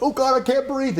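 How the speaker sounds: high gasping panic, half-joking; mock panic. Telling friends about the waterfall, mock drowning panic. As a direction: squeak it out like you're drowning. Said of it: "you're like".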